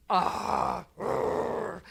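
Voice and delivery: mock grumbling growl, 'ah, grr'; grumpy growl. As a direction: growl like a cranky coworker. Mimics the grumpy colleague's growl.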